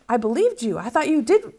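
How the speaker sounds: ordinary talk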